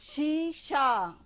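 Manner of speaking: शीशा said very slowly with a clear palatal श; slow demo word. Shown as a demonstration. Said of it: "ये श". (शीशा बहुत धीरे, श साफ़ खींचकर)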